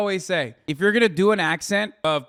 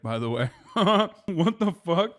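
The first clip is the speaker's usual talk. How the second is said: laughing. Real, not acted. Laughs at the clip.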